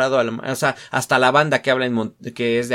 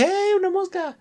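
sudden much higher exclaim at a fly; suddenly spot the fly mid-sentence; distracted surprise.

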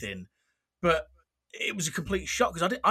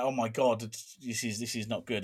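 quiet dismay; 5 semitones lower, 6 dB quieter than usual. Retold or imitated. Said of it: "I remember just thinking".

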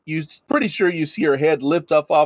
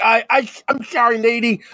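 higher, stammering apology; nervous Haggis; 7 semitones higher than usual. Acted. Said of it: "Haggis looks a little nervous".

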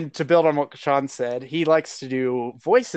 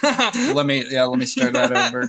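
laughing; 10 semitones higher, 4 dB louder than usual. Real, not acted. Laughing after a flub.

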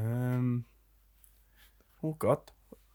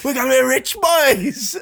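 gleeful; 9 semitones higher, 3 dB louder, slower than usual. Acted. Joking about riches, mock pirate.

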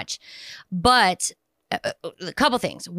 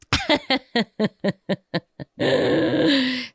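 long laugh; 5 semitones higher than usual. Real, not acted. Laughing fit after joke.

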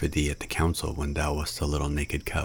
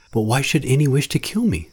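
alarmed question; 9 semitones higher, 5 dB louder than usual. A narrator's reading.

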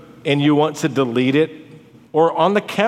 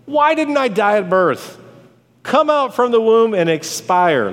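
higher, dramatized lamenting question; anguished plea; 7 semitones higher than usual. Acted. Preacher voicing Job's complaint to God, anguished plea. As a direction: cry it out as a complaint.

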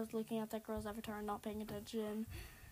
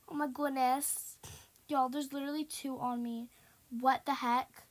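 usual speaking voice

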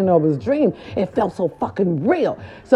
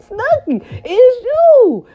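much higher, louder starstruck cry; excited; 4 dB louder, much slower than usual. Retold or imitated. Vlogger replaying her starstruck cry at Snooki. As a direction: gasp her name like a starstruck fan. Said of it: "why you so excited".

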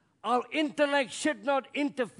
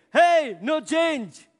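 louder, higher, slow outburst; exasperated Naaman; 8 semitones higher, 8 dB louder, much slower than usual. Pretend. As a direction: drag out a frustrated cry of no change. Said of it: "he's thinking".

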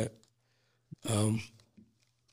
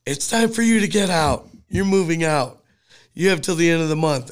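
mock stern mom; 5 semitones higher, 4 dB louder than usual. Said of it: "And then she said".

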